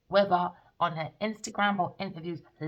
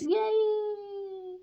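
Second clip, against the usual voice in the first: mock cheer; 14 semitones higher than usual.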